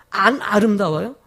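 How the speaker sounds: normal talking voice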